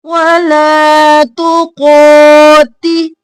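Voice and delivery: slow, drawn-out tajweed chant; Quran recitation. Shown as a demonstration. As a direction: recite it slowly, stretching the vowels. Reciting verse for students.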